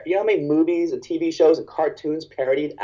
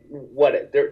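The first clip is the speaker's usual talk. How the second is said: ordinary talk, nothing marked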